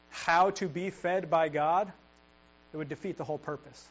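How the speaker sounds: ordinary talk, nothing marked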